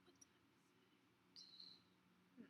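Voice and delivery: a barely voiced whisper, imitating the man; whispered to himself. Man at the bus stop whispering the time question again. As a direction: mutter it so quietly it hardly carries. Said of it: "literally that quiet".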